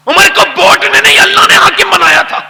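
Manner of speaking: high, loud preaching cry; impassioned shout. Real, not acted. Preacher shouting to the congregation, loud and high. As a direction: proclaim it to the whole crowd. Preacher proclaiming Umar's rule.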